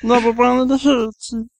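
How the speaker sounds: high cartoon squeak; Meatwad voice. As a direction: squeeze it up high like a cartoon meatball. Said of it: "go on a little bit more".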